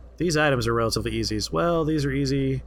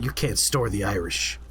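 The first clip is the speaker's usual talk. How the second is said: low menacing warning; mock threat; 6 semitones lower than usual. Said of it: "Why does it sound like a threat".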